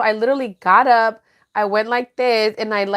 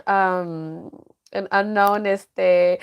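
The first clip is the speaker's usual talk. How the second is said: ordinary talk, nothing marked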